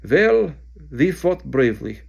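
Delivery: put-on loud, lightly accented rueful minister; gloomy voice. Bulgarian minister speaking behind Harry, gloomy. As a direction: say it with a wry sigh. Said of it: "said a gloomy voice".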